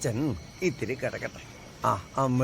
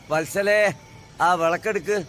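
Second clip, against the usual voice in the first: raised, higher call across the house; 6 semitones higher, 4 dB louder than usual. Acted. Grandfather calling for the lamp in a power cut, raised voice. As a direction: call loudly to someone elsewhere.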